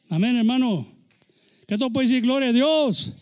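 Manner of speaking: high, loud call to praise; rousing preacher shout. Real, not acted. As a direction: lift the whole church up. (grito de júbilo)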